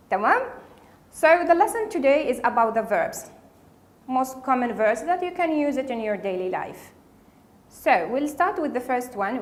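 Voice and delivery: usual speaking voice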